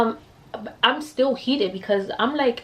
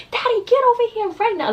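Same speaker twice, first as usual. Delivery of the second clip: high, urgent demand to her father; retold panicked call to dad; frantic call to dad; 4 dB louder than usual. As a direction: plead for dad to come now. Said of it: "I was like".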